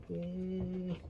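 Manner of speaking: ordinary talk, nothing marked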